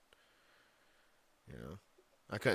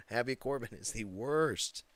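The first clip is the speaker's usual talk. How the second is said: ordinary delivery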